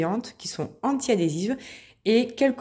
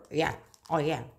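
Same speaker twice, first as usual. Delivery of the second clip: mock English accent; 4 semitones lower, 6 dB quieter than usual. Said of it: "Vous avez vu mon accent?".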